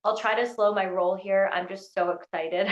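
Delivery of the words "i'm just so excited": usual speaking voice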